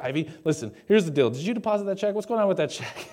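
mock freak-out, pitch climbing as it goes; building into comically agitated questioning; worked up. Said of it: "I'm just getting worked up right now".

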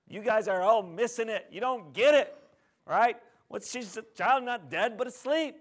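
acted exasperation, high and loud; exasperated rebuke. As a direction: set the crowd straight, forcefully. Jesus rebukes the wailing mourners.

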